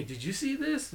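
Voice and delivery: high-pitched retold question from his brother; playful high voice, brother asking; imitating his brother. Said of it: "Or he'll tell me".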